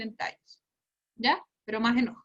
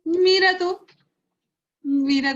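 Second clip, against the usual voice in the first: "Mira tú" said much higher and louder, amazed; impressed surprise; 7 semitones higher, 6 dB louder, slower than usual. Real, not acted. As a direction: sound truly impressed by the answer. Impressed by student's answer.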